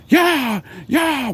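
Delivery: very high mock cries to horses; driving horses on. Vampire riding off down cobblestone streets, high urging cries.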